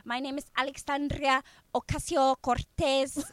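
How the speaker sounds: "Alexandria Ocasio-Cortez" rolled out with Spanish vowels, mocking; mock Spanish accent. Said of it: "don't speak the whole entire sentence like".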